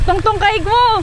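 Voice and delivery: calling out. Real, not acted. Calling out while running.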